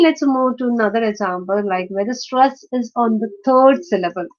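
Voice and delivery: ordinary delivery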